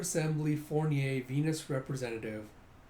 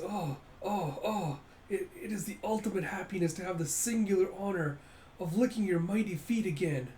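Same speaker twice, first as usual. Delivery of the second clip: higher-pitched, gushing minion; raised voice, servile delight; fawning servant; 5 semitones higher than usual. Acted. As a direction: gush as if honored to grovel. Servant fawns over master's feet.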